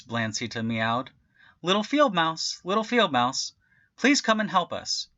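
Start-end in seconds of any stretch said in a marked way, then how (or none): 1.6-5.1 high, bright calling. A narrator's reading. Cat calls the little field mouse, calling out. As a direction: call the tiny mouse from afar.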